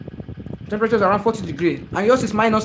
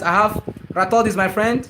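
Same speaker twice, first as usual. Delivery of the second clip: normal talking voice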